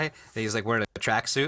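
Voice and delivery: ordinary talk, nothing marked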